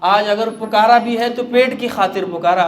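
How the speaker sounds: put-on old singer crying out to God. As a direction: cry out to God in remorse. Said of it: "رونے لگا اور چیخنے لگا".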